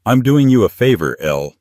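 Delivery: patronizing, high and loud, then dropping on the name; condescending. A narrator's reading. Man dismissing his partner of seven years, condescending. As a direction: talk down to her as if being generous. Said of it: "his voice dropping to that condescending tone".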